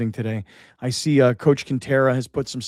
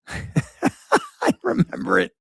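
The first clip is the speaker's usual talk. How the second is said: laughing; much slower than usual. Real, not acted.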